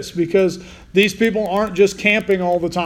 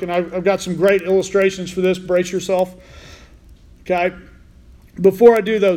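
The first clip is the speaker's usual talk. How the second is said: ordinary talk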